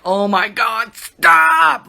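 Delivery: loud exasperated stop; mock-losing it with the kids; retold yell at kids. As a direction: snap at the kids, loudest on stop. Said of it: "losing my temper and yelling at them".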